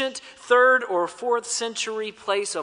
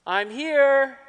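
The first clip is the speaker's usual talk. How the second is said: calling back; 6 semitones higher than usual. Retold or imitated. Answer from fog.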